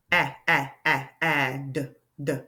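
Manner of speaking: P, repeated short A, then a separate D; sounding out. Shown as a demonstration. Teacher listening for the short vowel, sounding out pad. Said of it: "short vowel sound".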